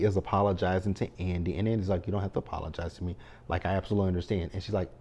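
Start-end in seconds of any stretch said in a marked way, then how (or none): 2.1-4.5 fast, softer hurried reassurance; rushed brush-off. Retold or imitated. Andy brushing off Penelope's apology, quick and soft. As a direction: rattle it off to skip the subject.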